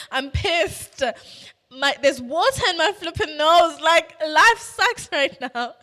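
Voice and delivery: loud, high comic tantrum; mock outrage. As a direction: rant in hammed-up self-pity, stretching the end. Acts out furious self-pity in toilet.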